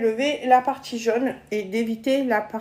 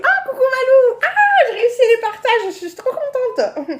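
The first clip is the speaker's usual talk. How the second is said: much higher and louder, bubbling with delight; excited greeting; 10 semitones higher, 5 dB louder than usual. Real, not acted. Greeting friends arriving on her live, thrilled and high. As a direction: squeal hello to a friend who just joined.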